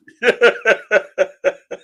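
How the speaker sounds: loud high burst of laughter. Real, not acted. Laughing at a reading.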